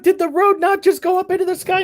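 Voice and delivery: high, frantic disbelief; shrill panic about the road; panicked question. Retold or imitated.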